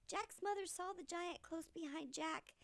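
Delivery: ordinary delivery, nothing marked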